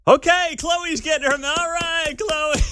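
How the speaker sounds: high, loud, playful coach call; amused coach. Retold or imitated. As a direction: announce her like a bemused coach. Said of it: "They're like".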